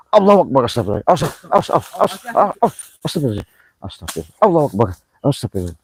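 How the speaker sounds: jumping at something in the dark; panicked prayer. Real, not acted. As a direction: blurt out the prayers in sudden fright. Startled panicked exclamations.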